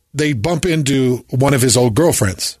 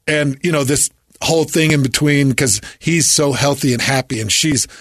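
usual speaking voice

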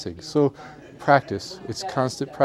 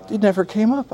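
higher-pitched imitation of her complaint; lightly voiced woman, pitched up; retold fiancee; 9 semitones higher than usual. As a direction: mimic her reply in a high voice. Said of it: "She's just like".

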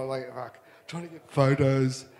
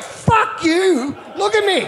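high, loud yell through laughter; laughing mock yell; 6 dB louder, slower than usual. Surfer retelling yelling at Johnny Boy while injured, laughing yell. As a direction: hurl it while cracking up. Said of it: "I was like".

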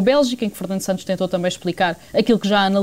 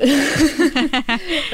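short laugh; 7 semitones higher than usual. Real, not acted. Reporter laughs about the heat.

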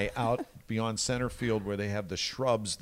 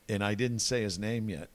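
ordinary talk

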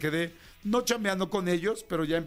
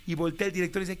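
usual speaking voice

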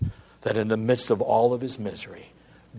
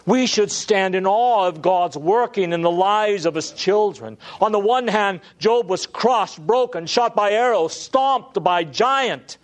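high, loud preacher's build; impassioned pulpit preaching; preaching at full force; 8 semitones higher, 4 dB louder than usual. Acted. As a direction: lift the congregation with rising fervour. Preaching Job's suffering.

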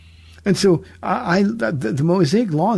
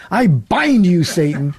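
mock exorcism; 6 dB louder, slower than usual. Radio host jokingly rebuking cherry cobbler temptation, loudly. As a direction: playfully cast out temptation at full voice.

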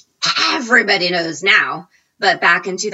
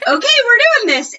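much higher, louder, quick switch to showtime; showtime rush; 9 dB louder, faster than usual. Retold or imitated. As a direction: snap into on-air energy. Lights go on, show starts.

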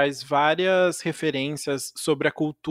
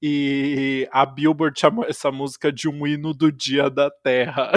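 usual speaking voice

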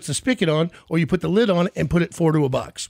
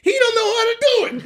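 much higher, louder jeering singsong; loud mocking taunt; 4 dB louder than usual. Coworker standing up to jeer at the new guy, shrill high taunt. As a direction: jeer it to the whole line, pitched way up. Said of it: "he stands up and goes".

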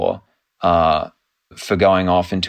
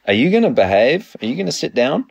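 higher, sing-song scolding of a pet; asking the cat to behave, in a higher pet-talk voice; 6 semitones higher, faster than usual. Real, not acted. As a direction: coax the cat to settle down, pitched up. Scolding her cat mid-interview.

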